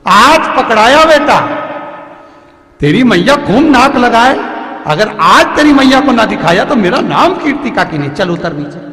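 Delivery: loud, high gloating scold; aunt catching the butter thief, loudly gloating; triumphant scolding. Acted. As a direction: caught you at last.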